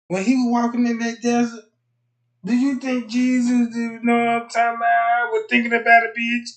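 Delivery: high, loud preaching boom; preacher voice. Retold or imitated. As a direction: preach it up to the rafters. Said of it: "Be like".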